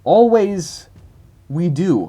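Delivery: slow, word by word, stumbling through broken English; slow halting read. Shown as a demonstration. Reading a garbled fan comment aloud to viewers, slow and halting. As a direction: sound out each word like deciphering a puzzle. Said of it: "Let me try it again".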